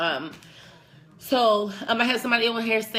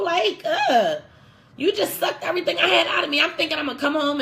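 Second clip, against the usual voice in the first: much higher and louder, laughing through the anger; exasperated outburst; 8 semitones higher, 3 dB louder than usual. Retold or imitated. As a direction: vent at the partner who drained you. Exasperated outburst at draining partner.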